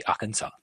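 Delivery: "Arkansas" ending on an open "-sa", no S; silent S. Shown as a demonstration. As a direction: read "Arkansas" as French, dropping the last S. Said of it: "you don't pronounce the S".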